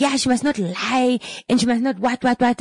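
high-pitched mock client rant; riled-up client. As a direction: imitate a client escalating, pitch way up. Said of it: "And she's going up, right?".

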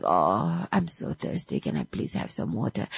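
low, faint, hoarse croak; hoarse weak voice. Retold or imitated. Patient just out of a coma asking his wife for water, faint hoarse croak. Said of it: "So you're speaking like".

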